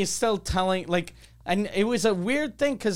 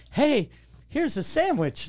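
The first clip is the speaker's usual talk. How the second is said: a hint of a chirpy attendant; 9 semitones higher, slower than usual. Flight attendant offering sandwich.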